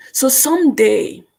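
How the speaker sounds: usual speaking voice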